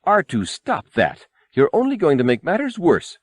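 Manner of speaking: high, fussy scolding droid voice, pitched well up; incensed droid. A narrator's reading. The golden droid scolds R2, high and fussy. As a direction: scold R2 like a prissy butler robot. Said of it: "Three P O was incensed".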